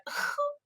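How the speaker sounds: high, soft nervous giggle; awkward laugh. Retold or imitated. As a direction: let out an awkward little laugh. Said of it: "I went".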